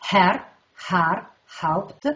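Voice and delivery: "Herr, Haar, Haupt" with an audible breathed h, word by word; "Herr, Haar, Haupt" as a list of glottal fricatives; sounding the h. Shown as a demonstration. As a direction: say "Herr, Haar, Haupt" separately, showing each initial h. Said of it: "domina la fricativa glottidale sorda".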